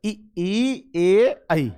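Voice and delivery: ई, ई, ए, ऐ recited separately from the front of the tongue; front vowels. Shown as a demonstration. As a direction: sound out ई, ई, ए, ऐ well forward. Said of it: "लगेगा कि आगे से बोल रहा". (ई, ई, ए, ऐ आगे से)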